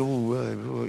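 low, soft nervous ooh; a hammed-up little flinch of nerves; mock intimidated. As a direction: shrink back like you're rattled. Acting out feeling intimidated.

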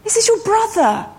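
high, pleading father's voice; the father pleading with his angry older son. Acted. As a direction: beg him gently as the father. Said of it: "Begged him".

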